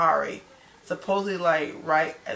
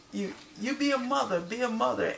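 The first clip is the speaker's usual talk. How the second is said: higher-pitched mock telling-off; retold scolding; 6 semitones higher than usual. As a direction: imitate someone scolding, voice lifted. Retold insult during a fight.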